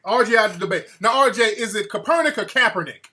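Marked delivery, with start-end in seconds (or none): 1.4-3.1 first Kaepernick as "kuh-PER-nick", second as "CAP-er-nick"; higher-pitched question weighing two stresses of Kaepernick; contrasting pronunciations. Shown as a demonstration. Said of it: "I'm all putting the emphasis on the wrong".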